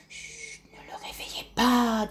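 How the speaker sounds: hushed whisper; whispering to not wake the baby. Acted. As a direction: shush them softly. Hushing so puppy keeps sleeping.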